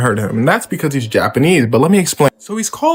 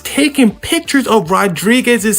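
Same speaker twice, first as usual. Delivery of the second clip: rising excitement; 10 semitones higher than usual. Acted. Tense build-up in the story.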